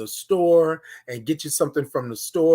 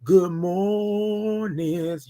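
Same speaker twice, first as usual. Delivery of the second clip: very slow "Good mornings"; drawn-out greeting; much slower than usual. Shown as a demonstration. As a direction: draw it out long. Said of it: "You gotta say".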